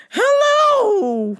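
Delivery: put-on very high, loud and slow old lady; old lady caller. Old lady caller demanding on phone, very high and slow. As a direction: quaver it out like a frail old lady.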